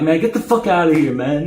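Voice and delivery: high, sharp scolding tone. Retold or imitated. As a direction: tell him off, half joking. Other guy scolding his friend.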